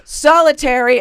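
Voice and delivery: high, loud yelled announcement; guard yelling. Retold or imitated. Guard yells to staff about the inmate, shouted. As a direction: yell it out like a guard down a hall. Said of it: "the lady yells".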